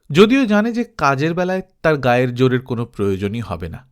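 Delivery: ordinary delivery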